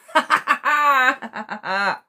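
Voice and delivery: high, loud burst of laughter; a squealing happy laugh; big delighted laugh. Real, not acted. Excited reading reaction, loud laugh.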